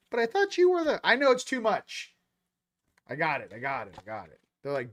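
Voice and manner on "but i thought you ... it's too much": high-pitched voice of a duped follower; mock protest. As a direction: whine it mockingly, way up high. Acting out the betrayed followers.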